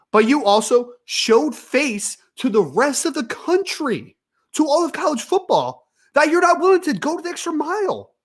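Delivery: high, exasperated and emphatic; frustrated rant. Real, not acted. Sports host scolding the program for showing face, frustrated. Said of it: "lower my tone here in a second 'cause I am super frustrated".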